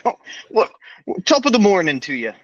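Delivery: high, quick mock-Irish lilt; hammed-up Irish greeting; Irish accent. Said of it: "year four in an Irish accent".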